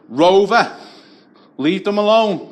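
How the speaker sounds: high and loud, like calling off a dog; calling the big lad off like a dog; mock dog owner's call. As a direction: bellow at him like a dog owner.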